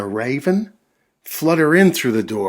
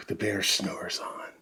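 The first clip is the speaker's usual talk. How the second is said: whispered refrain; 3 semitones lower, 9 dB quieter than usual. A narrator's reading. Narrator whispers to listeners, the bear snores on, whispered.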